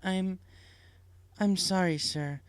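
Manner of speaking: apologetic, faltering nurse; hesitant nurse. Acted. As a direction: break it to them awkwardly. Nurse voiced in story.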